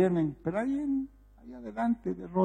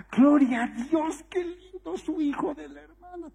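imitated rejoicing; 9 semitones higher than usual. Congregation rejoicing over the sister's son, imitated delight. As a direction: act out a glad congregation, pitched high. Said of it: "nos alegramos".

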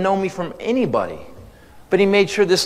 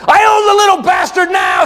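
higher, louder gloating shout; triumphant yell; 6 dB louder than usual. Retold or imitated. Mom gloating to dad on payphone, shout. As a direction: bellow it like you won the war.

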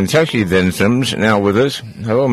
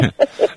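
high laugh. Real, not acted. Laughs at own joke.

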